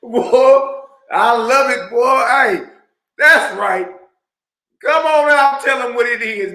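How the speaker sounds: loud, high hype shouts at the clip; hollering with joy as the clip lands; excited shouting. Real, not acted. Host cheering on the clip, loud excited shouting.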